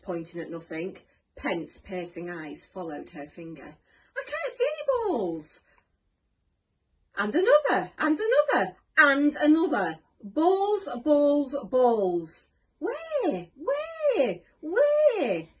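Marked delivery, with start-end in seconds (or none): 4.2-5.6 very high, loud wail; frustrated wail. A narrator's reading. Teacher following the pupil's pointing finger, wailing. 7.2-12.5 high, loud, insistent pointing out; excited calling out. A narrator's reading. Pupil showing the teacher imaginary balls, excitedly. 12.8-15.5 very high, loud yelps; frantic questioning. A narrator's reading. Teacher hunting invisible balls, shrieking frantically.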